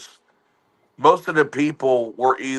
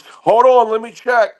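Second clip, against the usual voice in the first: high, louder, casual reply; son's voice; 7 semitones higher, 4 dB louder than usual. Retold or imitated. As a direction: answer mom from across the room. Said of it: "He goes".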